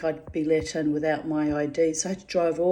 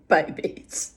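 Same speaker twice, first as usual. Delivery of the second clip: ordinary delivery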